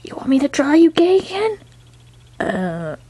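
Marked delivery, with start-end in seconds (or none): 0.0-1.6 higher, quick teasing; mock-sweet taunt. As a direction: tease it up high and fast. Teasing character voice.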